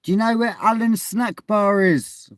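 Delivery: high loud call to get attention; loud high-pitched call; calling out loudly. Real, not acted. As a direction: shout across to a stranger. Calling out to man outside.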